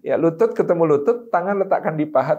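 ordinary delivery

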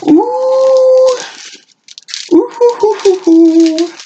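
very high, loud hooting squeals of joy; high, loud, delighted hooting squeals. Real, not acted. Reacting to the last gift.

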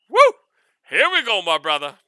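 loud excited celebration; hyped cheer. Real, not acted. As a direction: whoop it up. Cheering after finding the bottle.

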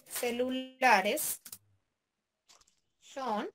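drawn-out words with long gaps; slow, halting write-along; dictating while writing. Real, not acted. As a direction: stretch each word as you type it. Saying words while typing them.

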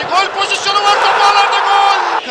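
screaming football commentator. Real, not acted. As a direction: scream the goal call. Second commentator screams goal.

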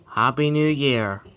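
loud slow cheer; new year countdown crowd. Real, not acted. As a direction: shout it out at midnight. Cheer at midnight.

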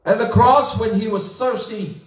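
ordinary talk, nothing marked

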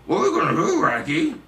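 loud, high cartoon dog growl-talk; cartoon dog impression. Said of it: "How about Shaggy from Scooby-Doo?".

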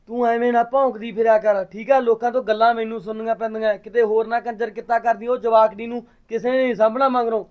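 loud, high scolding yell; angry shouting. Acted. Brother shouting at his sister over village gossip, furious. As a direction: yell at her to stop running around.

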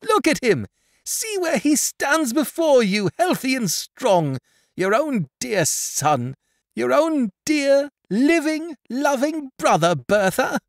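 high, jubilant proclamation; ecstatic cry. A narrator's reading. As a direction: cry out in ecstasy, lingering on the endearments. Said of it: "clapping them in ecstasy".

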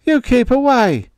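high and loud in terror; wild gasp. A narrator's reading. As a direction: gasp it out wildly. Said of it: "gasped wildly".

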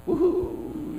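high, half-hearted mock cheer; a limp little cheer that falls flat; pathetic woohoo. Pastor mocking victory cheer to congregation, weak high woohoo. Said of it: "it sounds kinda pathetic".

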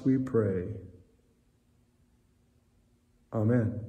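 hushed church prayer. Acted. Leads a closing prayer.

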